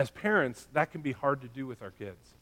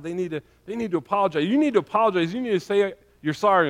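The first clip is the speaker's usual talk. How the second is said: mildly acted insistent parent, louder and higher; demanding parent; 4 semitones higher, 7 dB louder, faster than usual. Parent ordering a child who hurt somebody, mildly demanding. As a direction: lightly play a parent insisting on an apology. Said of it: "as a parent, we're like".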